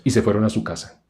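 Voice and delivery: ordinary delivery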